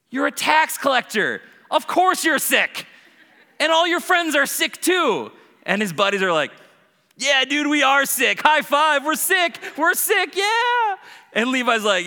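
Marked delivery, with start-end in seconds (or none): 0.1-5.3 high, loud, slow obviousness; emphatic retort. Acted. Jesus telling Levi the tax collector the truth, emphatic. 7.2-11.0 high, loud whooping agreement; rowdy cheering. Acted. Levi's buddies high-fiving over being sick, rowdy cheer.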